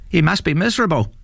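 high, quick possum sympathy; sympathetic possum. A narrator's reading. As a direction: pity him in a high, quick voice. Possum pities the troll.

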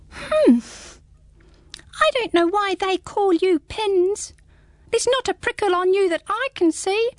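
high, small squeaky creature; a tiny curious hedgehog peering up; high little hedgehog, puzzled. Acted.

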